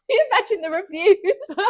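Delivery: high, loud words through helpless laughter; laughing. Real, not acted. Host laughing helplessly at the joke with her co-host. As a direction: lose it laughing as you talk.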